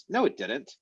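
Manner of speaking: high-pitched mock denial; contradicting. Teacher voicing the other side of a dispute, contradicting. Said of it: "there's been some kind of conversation like".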